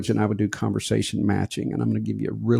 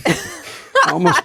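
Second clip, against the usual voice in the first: ordinary talk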